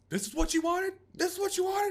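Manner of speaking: very high, full-force yell; furious shout. Retold or imitated. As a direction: reenact a desperate scream at someone. Retold armed confrontation, screaming back.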